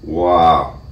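impressed exclamation; amazed. Real, not acted. Amazed at the finished coffee.

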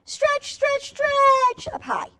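put-on stretchy high call to children; high stretched-out call. Shown as a demonstration. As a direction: stretch up high, drawn out and sung high. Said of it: "Can everybody stretch?".

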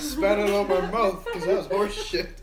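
laughing hard, high and loud. Real, not acted. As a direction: talk through big laughter. Laughing after spitting out water.

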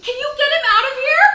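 loud, high demand; panicked, high-pitched yelling; exasperated shout. Acted. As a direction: yell for him to be removed. Demanding the stranger be removed.

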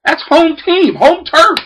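high, hammed-up boyfriend voice; mock boyfriend. A boy bragging to his girlfriend's dad, hammed-up and high. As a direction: play the eager boy's bravado.

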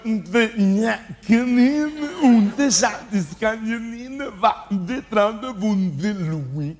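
very slow, drawn-out gibberish; said slowly. Pretend. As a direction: stretch every syllable out slowly. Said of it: "Puoi ripeterlo un po' più adagio".

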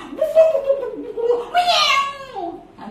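loud gurgle into a high meow; dunked cat. Acted. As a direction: splash noises, then a long cat wail. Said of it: "that's the cat".

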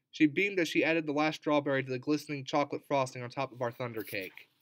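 plain reading voice

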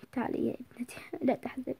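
soft whisper, gentle; whispered comfort. Acted. Father whispering comfort to his sad daughter. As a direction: hush her tenderly.

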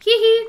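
a high, loud little hee-hee chuckle; mock giggle. Scrooge giggling over his own donated exhibition.

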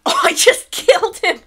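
high, loud yelp of shock; shocked outcry. Real, not acted. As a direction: cry out at what you just did. Shocked by accidental kill.

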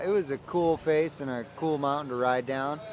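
ordinary delivery

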